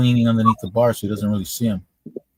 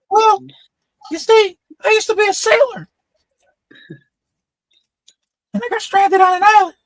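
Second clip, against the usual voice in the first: very high squeaky voice, a laugh at the start; high-pitched little guy. Retold or imitated. Tiny-headed man tells the bartender his story, high squeak. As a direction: keep the squeak through the tale. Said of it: "The guy has a really high-pitched voice".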